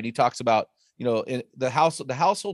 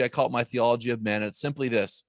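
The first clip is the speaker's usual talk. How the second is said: usual speaking voice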